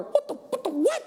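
high, stunned what-the stammer; shocked disbelief. Retold or imitated. Coworkers reacting to the smiling guard, high stunned sputter. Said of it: "they're losing it".

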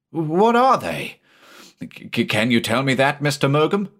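stare at the sea in dread; fearful. Acted. Frightened captain asks.

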